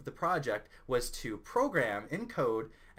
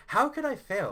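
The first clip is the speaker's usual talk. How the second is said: high, loud retold disbelief; indignant; 11 semitones higher, 8 dB louder than usual. Self at the laptop after failing, indignant outcry. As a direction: demand it as if it's unfair. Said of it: "I was so mad".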